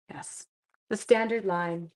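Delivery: ordinary talk, nothing marked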